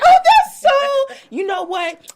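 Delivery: high, loud, drawn-out gushing; mock parental delight. Mother praising child's good day, mock thrilled. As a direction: gush at a child, hugely overdone. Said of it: "And I'm like".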